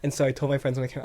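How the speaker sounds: usual speaking voice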